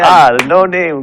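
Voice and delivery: loud, high surprised exclaim. Retold or imitated. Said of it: "they're like".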